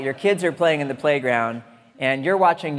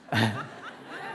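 short laugh; 3 semitones lower, 6 dB quieter than usual. Real, not acted. Laughs at two-book deal.

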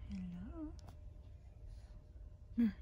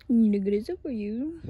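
much louder playful teasing; 13 dB louder than usual. Real, not acted. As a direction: call it out loud and playful.

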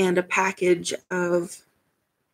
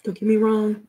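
usual speaking voice

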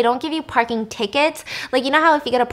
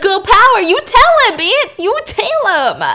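put-on high, loud girl-power cheer; exaggerated high-pitched hype; hammed-up cheer; 10 semitones higher, 7 dB louder than usual. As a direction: egg her on, laughing, drawing out the last words. Acts out cheering for the wife.

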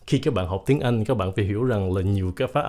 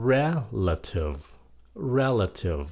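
RELATIVE slowly, stressed as REL-a-tive; stress on first syllable; slower than usual. Shown as a demonstration. Teacher gives the correct stress, slow REL-a-tive. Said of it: "đúng nhấn âm là nhấn âm một".

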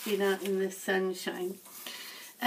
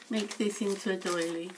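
normal talking voice

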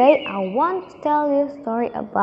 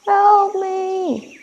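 louder, higher desperate call; loud cry for help; 6 semitones higher, 4 dB louder than usual. Acted. Lion caught in the hunters' net, shouting for help. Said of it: "and he roared loudly".

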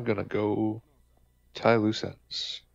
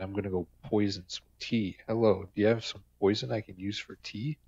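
ordinary talk, nothing marked

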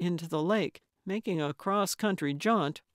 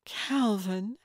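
tentative questioning rise on the name; hesitant recognition; 3 semitones higher than usual. A narrator's reading. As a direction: draw the name out as an uncertain question. Old friend recognising visitor at door.

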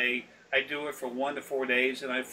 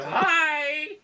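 cheery hi; 11 semitones higher, 4 dB louder than usual. Retold or imitated. Greeting men staring at him.

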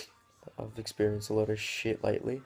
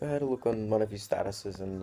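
ordinary delivery

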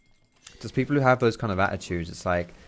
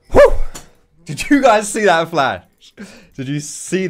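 loud, high burst of amazement; yelling to the team, hyped; excited shout; 9 semitones higher, 11 dB louder than usual. Real, not acted. Gamer shouting to his team about the flash, excited.

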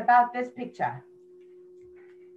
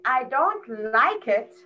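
slow model sentence. Shown as a demonstration. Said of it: "We can say".

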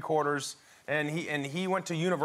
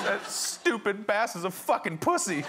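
son's outburst; 6 semitones higher than usual. Retold or imitated. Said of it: "he goes".

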